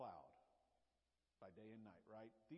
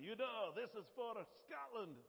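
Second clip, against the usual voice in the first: rousing Scottish battle cry, higher and louder; hammed-up Scottish accent like a Braveheart rally; 8 semitones higher, 7 dB louder than usual. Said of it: "that's my best Scottish accent".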